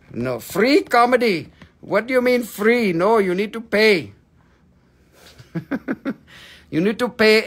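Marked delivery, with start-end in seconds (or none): none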